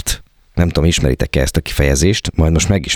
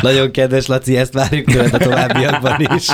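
laughing; 6 semitones higher than usual. Real, not acted. Teasing colleague while laughing.

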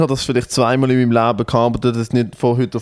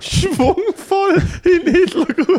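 high-pitched, drawn-out laughing words; laughing high squeal; much slower than usual. Real, not acted. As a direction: squeal it out slowly through laughter. Mocking the exaggerated salute.

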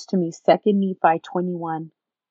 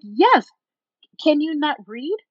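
higher, louder exasperated retort; argumentative; 7 semitones higher, 4 dB louder, slower than usual. Retold or imitated. Boyfriend arguing scripture with her, argumentative. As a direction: snap back, imitating his side of the fight. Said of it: "So now we're fighting".

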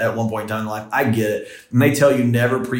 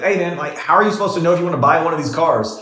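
calling him out; 6 semitones higher than usual. Retold or imitated. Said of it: "talking at the old guy".

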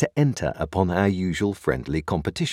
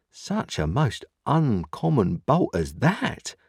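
slow, higher-pitched confidential wonder; 7 semitones higher, slower than usual. A narrator's reading. Joe marvelling at Pip's bolting, confidentially. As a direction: draw each word out slowly, pitched up. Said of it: "speaking in a confidential voice".

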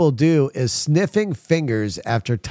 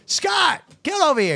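high shouted call across the room. Retold or imitated. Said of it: "I'm like".

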